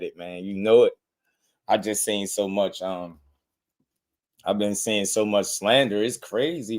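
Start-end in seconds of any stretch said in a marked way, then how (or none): none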